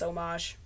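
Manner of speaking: "homage" as oh-MAHZH; soft zh ending on "homage", oh-MAHZH; French homage. Shown as a demonstration. Guessing the French, "homage" as oh-MAHZH. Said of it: "I bet you in French".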